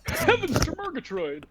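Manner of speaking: high-pitched put-on cartoon voice; cartoon catchphrase. Player chiming in after the silly voice, lightly cartoonish. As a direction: exclaim it like an old cartoon character.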